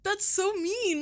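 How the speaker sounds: high, slow words squeezed out through laughter; laughing. Real, not acted. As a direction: crack up while protesting. Reacting to the beans question.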